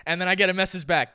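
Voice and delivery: ordinary talk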